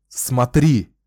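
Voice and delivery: loud, sharp bark of a command; barked order. A narrator's reading. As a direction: bark it out to force obedience. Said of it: "рявкнул Ричард".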